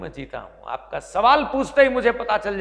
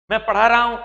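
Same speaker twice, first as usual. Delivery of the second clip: loud, higher mock of the rote answer; mock declaration; 5 semitones higher, 9 dB louder, faster than usual. Students reciting the translation they learned all their lives, loudly. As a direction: announce the rote translation like a proud pupil. Mocking the habitual Hindi translation.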